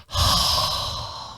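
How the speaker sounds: long breathy exhale; relieved sigh. Shown as a demonstration. Imitates eye relieved by drops.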